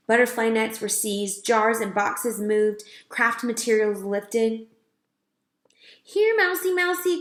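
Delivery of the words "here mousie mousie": high, sing-song call; calling a pet. A narrator's reading. Girl calling the lost mouse, sing-song. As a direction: coax the mouse out. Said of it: "called Lana".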